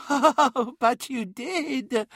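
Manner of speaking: high, drawn-out gloating with a laugh; chuckle, then stretch the words out gleefully; mocking laugh. A narrator's reading. Daemon contradicts Magnus about the bargain, laughing mockingly. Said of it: "laughed the eyes".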